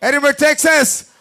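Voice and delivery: loud, slow hometown hype call. Real, not acted. Hype host calling hometown fighter.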